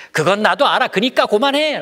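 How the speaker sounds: high, loud impatient cut-off; curt interruption. Acted. Child cutting off a friend, loud and high. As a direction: snap it to shut them up. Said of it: "말을 딱 잘라요".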